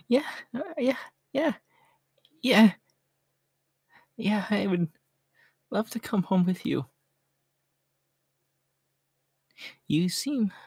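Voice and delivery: high, bursting with giddy joy; excited. Acted. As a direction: squeal yes like a thrilled stray. Stray overjoyed at adoption.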